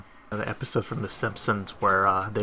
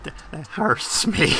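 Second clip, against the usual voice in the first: normal talking voice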